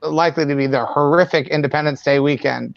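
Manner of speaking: usual speaking voice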